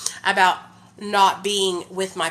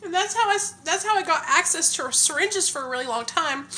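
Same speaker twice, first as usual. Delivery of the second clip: tearful; 11 semitones higher than usual. Real, not acted. Mother confessing to viewers how she got syringes, tearful. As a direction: let the tears push the voice up.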